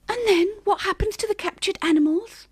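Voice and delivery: high little mouse voice; small high-pitched squeak; squeaky mouse. A narrator's reading. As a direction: squeak the question timidly like a mouse. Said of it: "the mouse squeaked".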